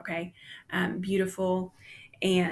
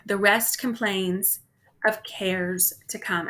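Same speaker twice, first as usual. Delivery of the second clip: slow reading. Shown as a demonstration.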